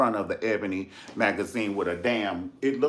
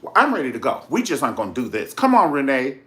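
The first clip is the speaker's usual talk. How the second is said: louder, firm, fed-up voice; fed up, ordering Renee to leave; angry, done; 4 semitones higher, 8 dB louder, faster than usual. Retold or imitated. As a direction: call the session off firmly. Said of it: "she got some bass in her voice".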